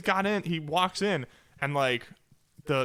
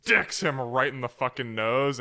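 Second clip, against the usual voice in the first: usual speaking voice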